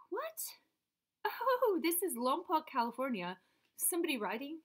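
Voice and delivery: sudden high what; shocked by what she found; surprised. Real, not acted. Surprised at a find.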